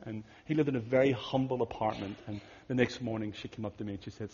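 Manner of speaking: ordinary talk, nothing marked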